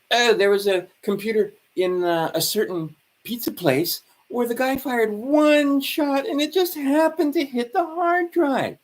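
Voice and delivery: sing-song mockery of a story nobody should buy; mocking sarcasm.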